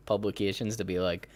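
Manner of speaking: normal talking voice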